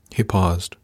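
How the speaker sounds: usual speaking voice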